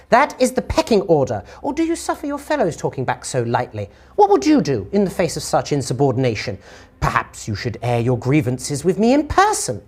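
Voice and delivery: imperious lady, swooping up high; distorted female voice. Acted. Lady reminds Lorik of the pecking order, imperious. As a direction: put an underling in their place as a haughty lady. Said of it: "uh, female, but it sounds distorted".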